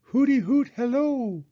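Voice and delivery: high, loud hooty call; hooting owl. Acted. Owl calling back to the girl. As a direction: hoot a hello like an owl. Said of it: "Owl called back".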